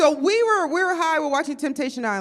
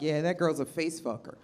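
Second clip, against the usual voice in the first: low dude voice; 7 semitones lower, 6 dB quieter than usual. Retold or imitated. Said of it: "they were like".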